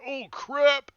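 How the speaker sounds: a sudden high, loud yelp of alarm; high, loud startled cry; startled npc. Acted. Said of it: "He's like".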